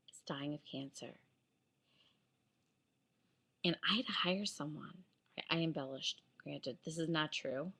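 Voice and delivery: soft, cold threat through a whisper; quiet icy threat to the bank manager; low menacing whisper. Retold or imitated. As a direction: lean in and hiss it quietly. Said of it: "I swear this is how I was talking".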